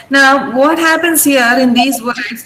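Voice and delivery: ordinary talk, nothing marked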